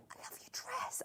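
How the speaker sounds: barely audible, imitating a young girl; hushed quote. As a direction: quote her in a near-whisper. Retells young girl's remark.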